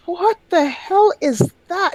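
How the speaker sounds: high, drawn-out disbelief; shocked reaction. Retold or imitated. Recalling first date with Acadian speaker, high appalled disbelief.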